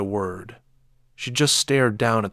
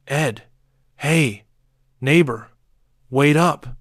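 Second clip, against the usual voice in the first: loud, higher calls across the yard; calling out; 5 semitones higher, 6 dB louder than usual. A narrator's reading. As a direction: hail him before he drives off.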